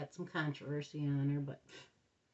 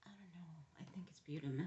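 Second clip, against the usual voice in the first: whispered; 2 semitones lower, 12 dB quieter, much faster than usual. Real, not acted. Whispering before saying it aloud.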